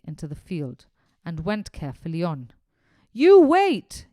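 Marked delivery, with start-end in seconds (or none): 3.1-4.1 sudden, much louder, higher bellow; furious yell. Acted. Farmer shouts after the boy in the field, furious yell. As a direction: roar it as a threat. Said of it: "yelled the farmer".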